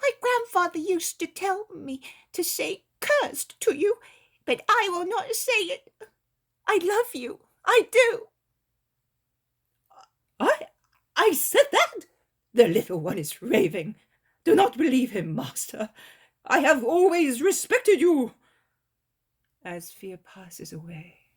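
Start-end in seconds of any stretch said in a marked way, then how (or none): 0.0-8.3 put-on high, bright-eyed child's voice, earnest; a little boy sincerely telling Jesus he loves him; innocent child. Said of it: "in the innocent voice of truth". 10.4-18.3 high, loud, hasty denial; an old man caught out, protesting; flustered old man. Acted. Old man denies in a panic.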